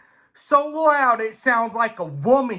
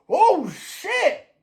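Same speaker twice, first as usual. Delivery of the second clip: much higher startled oh shit; 10 semitones higher than usual. Retold or imitated. The rival, shocked as he's passed, high startled oh shit. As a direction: blurt it as the rival is caught out. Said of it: "He goes".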